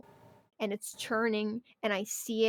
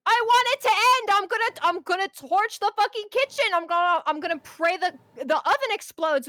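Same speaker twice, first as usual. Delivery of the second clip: raging yell, much higher and louder; furious rant; 8 semitones higher, 6 dB louder than usual. Retold or imitated. Fed-up cook ranting about the kitchen, furious yell. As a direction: scream it like you're about to torch the place. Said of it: "some days where I'm just like".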